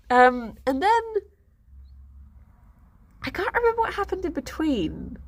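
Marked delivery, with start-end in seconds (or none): none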